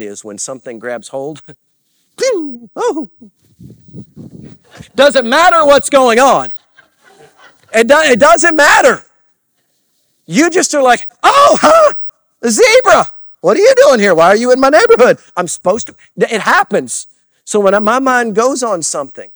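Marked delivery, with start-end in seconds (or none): 2.2-3.1 high-pitched "pew" and "oh"; sudden squeaky burst of distraction; high imitated zoom. Shown as a demonstration. Imitates a mind suddenly grabbed. 4.9-19.3 very high, loud comic outburst; hammed-up, shouted amazement; distracted excitement. Acting out a distracted ADHD mind.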